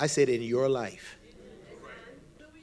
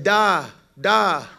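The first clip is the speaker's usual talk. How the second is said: put-on Russian-accented "Da, da," said louder; Russian yes; 4 semitones higher, 9 dB louder than usual. Preacher answering the Russian workman in Russian, emphatic. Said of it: "which is yes for Russian".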